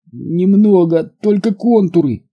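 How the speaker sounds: high, strained-sounding voice of the hurt man; weak injured patient. A narrator's reading. Injured Mike telling Newcombe what he sees, pained.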